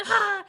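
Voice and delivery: high loud startled cry; startled shout. Retold or imitated. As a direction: burst out with a jolt. Samson startled.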